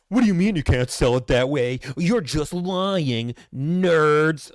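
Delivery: loud, put-out customer voice; mock outrage at the salesman; indignant customer. Customer quote acted loud.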